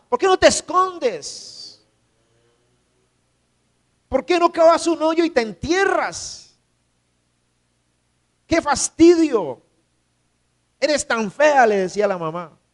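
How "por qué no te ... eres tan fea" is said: high, contemptuous sneer at his mother; scornful son. Retold or imitated. Son shaming his one-eyed mother, higher scornful sneer. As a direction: push her away with disgust, pitched up. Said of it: "él decía".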